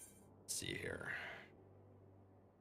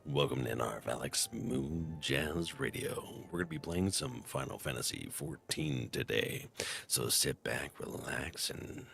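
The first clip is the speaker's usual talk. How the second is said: low, slow, smooth radio DJ voice; late night radio host voice; 8 semitones lower, slower than usual. Shown as a demonstration. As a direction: ease into a late-night jazz radio intro. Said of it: "do my radio host, late night, uh, radio host, uh, voice".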